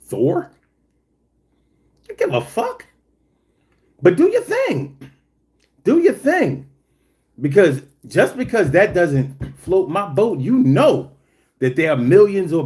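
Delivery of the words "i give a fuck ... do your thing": 1 mock indifferent voice. Mocking indifference to Marvel fans.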